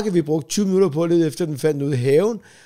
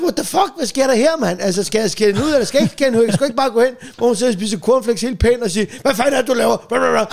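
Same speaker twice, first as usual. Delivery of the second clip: high, loud bewildered outburst; outraged disbelief; 6 semitones higher, 3 dB louder than usual. Retold or imitated. Husband protesting to wife, high and bewildered. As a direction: throw up your hands in disbelief. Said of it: "Hvor jeg så".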